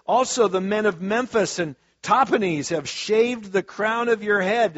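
loud, high prophetic reading; preaching scripture. Acted. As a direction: thunder the prophet's lament. Reads Jeremiah at full force.

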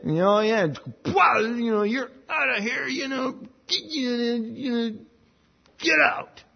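high, mocking brush-off; a hint of a God throwing you out; dismissive God. God as people imagine him, dismissing a confessor, mock high brush-off. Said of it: "Well, this is what I did".

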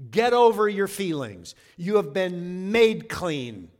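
loud, slow sermon emphasis; emphatic preaching. Real, not acted. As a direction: drive the point home to the congregation.

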